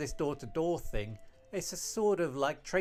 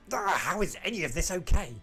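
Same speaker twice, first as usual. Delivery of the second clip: groan, then indignant question; exasperated outburst; 3 semitones higher, 4 dB louder than usual. Acted. Arguing with reckless friends, exasperated. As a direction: burst out in frustration. Acted quarrel, fed up with friends.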